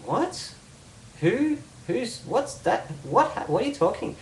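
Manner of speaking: high-pitched, baffled questions; confused listener. Acted. Listener trying to grasp a nonsense statement, utterly confused. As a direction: stammer questions as if totally lost. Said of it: "confused look, like".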